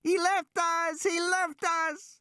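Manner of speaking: put-on high, slow, wailing child's cry; screaming child. Lex wails that Ed Regis abandoned them, screaming child. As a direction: wail it out like a terrified girl. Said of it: "Lex was screaming, in a steady, monotonous wail".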